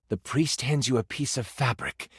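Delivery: ordinary talk, nothing marked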